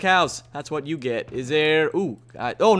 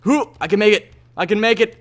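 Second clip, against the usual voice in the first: ordinary talk, nothing marked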